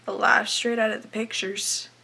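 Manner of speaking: ordinary delivery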